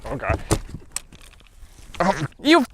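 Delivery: usual speaking voice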